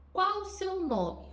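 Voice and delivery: slow, high \"Qual o seu nome?\" question; asking the name. Shown as a demonstration. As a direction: ask it slowly, stressing \"Qual\". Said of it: "Como que a gente pergunta". (perguntando devagar \"Qual o seu nome?\" com ênfase em \"Qual\")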